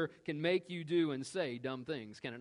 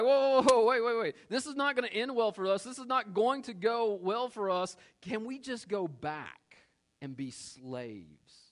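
panicked Israelites; 6 semitones higher than usual. Retold or imitated. Israelites begging Moses in mock panic, then fading small. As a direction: play the scared crowd begging to go back. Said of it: "They were like".

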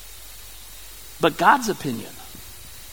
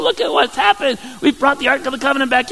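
excited David; 7 semitones higher, faster than usual. Retold or imitated. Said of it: "he's ready to come home and say".

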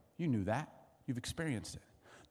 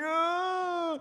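high imitated boy's cry; hammed-up childish bawl; mock wail; 5 dB louder than usual. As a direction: wail like a crying son. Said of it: "And he starts crying".

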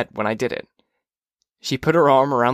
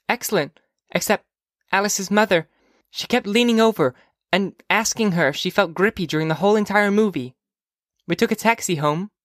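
put-on high, chattering little-girl voice; young girl Phoebe; 8 semitones higher than usual. Phoebe telling mother about the movie, high girlish voice. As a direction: report to mother in a high child's voice.

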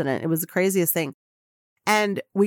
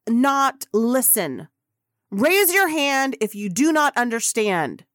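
stern publicist warning; 4 semitones higher than usual. Retold or imitated. Publicist threatens reporters with ejection, stern warning. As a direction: lay down the law, hitting key words hard.

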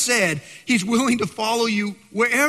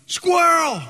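mock shout, much higher and louder; imitating a yell; 10 semitones higher, 5 dB louder than usual. Son spotting a squirrel from the car, mock excited yell. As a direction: yell it like a kid spotting one. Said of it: "somebody in my vehicle is yelling".

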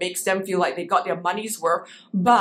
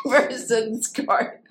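laughing; 11 semitones higher, much slower than usual. Real, not acted. Laughs correcting slip.

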